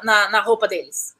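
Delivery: ordinary talk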